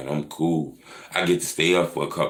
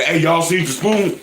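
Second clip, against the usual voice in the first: much higher, louder put-on nervous voice; mock scared guy; 11 semitones higher, 6 dB louder than usual. Mocking a scared viewer at home, high hammed-up call. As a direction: play a jumpy man asking for his spoon.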